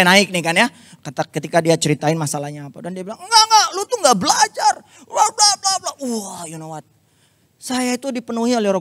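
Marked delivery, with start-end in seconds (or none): 3.2-5.9 high, loud scolding friend; angry scolding. Retold or imitated. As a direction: scold him like a furious friend. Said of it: "Dia marah-marah lagi". (teman memarahi dengan suara tinggi dan keras)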